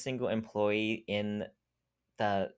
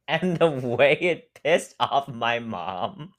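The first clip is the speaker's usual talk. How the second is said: laughing; 3 semitones higher, 7 dB louder, slower than usual. Real, not acted. Laughing at the story.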